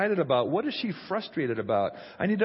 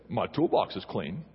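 mock clueless husband; 9 semitones lower than usual. Husband voiced answering literally.

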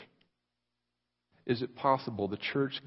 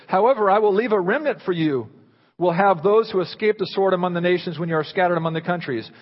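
louder, higher, forceful scripture reading; preaching scripture; 5 semitones higher, 9 dB louder than usual. Acted. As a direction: proclaim the verses to the whole hall. Reads scripture at full force.

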